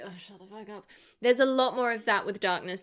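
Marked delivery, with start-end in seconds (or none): 0.0-0.9 under-breath, much softer brush-off; muttered dismissal. Retold or imitated. Reacting to a cringey villain, softly muttered. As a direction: mutter it to yourself in disgust. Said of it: "you're just like".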